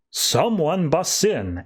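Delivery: suspenseful announcement, words dragged out; dramatic reveal. A narrator's reading. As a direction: stretch each word for a dramatic entrance. Dramatic entrance in a recap.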